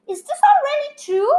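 put-on excited child asking; amazed. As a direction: gasp it out with wide eyes. Charlie amazed at grandpa's tale.

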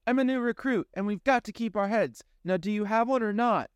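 high, firm insistence; insistent young woman. Acted. Pandora insists to the young man, high and firm. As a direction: keep him focused.

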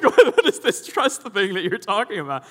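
high, loud baffled outburst; hammed-up bewildered protest; mock exasperation. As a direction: comically demand an explanation at the top of the voice. Acted exasperation at repeated answer.

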